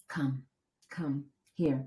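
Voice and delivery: quiet, gentle imitation of husband; soft beckoning. Husband calling the struggling runner over, imitated soft beckoning. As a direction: beckon softly, with small pauses.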